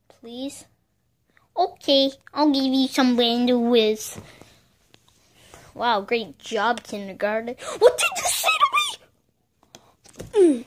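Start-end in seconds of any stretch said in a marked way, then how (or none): 0.1-0.7 meek unvoiced whisper; whispered please. Patient whispers to doctor for bandages. 7.8-10.7 mock indignant shout. Taking offense at kindergarten remark.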